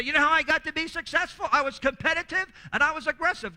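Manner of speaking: loud, high, self-satisfied bragging; smug boast. Retold or imitated. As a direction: brag like a proud self-made parent. Imitating a proud successful parent.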